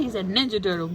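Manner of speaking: usual speaking voice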